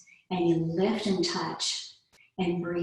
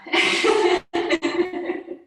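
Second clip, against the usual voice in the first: high loud airy cackle of laughter; loud high unvoiced laughing fit; big laughter-yoga laugh; 12 semitones higher, 7 dB louder than usual. Shown as a demonstration. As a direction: let a big laugh burst out. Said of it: "when we're laughter yoga".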